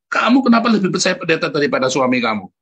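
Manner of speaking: loud scolding rebuke; scolding the wife. Retold or imitated. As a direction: scold her like an angry husband. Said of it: "Istri saya tak marahi ya". (memarahi istri dengan suara keras)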